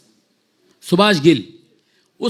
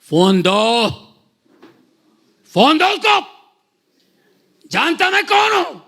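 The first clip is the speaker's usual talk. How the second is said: much higher, demanding yell; retold shout; 8 semitones higher than usual. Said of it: "मैंने जोर से चिल्लाया ना". (ज़ोर से चिल्लाकर फ़ोन माँगना)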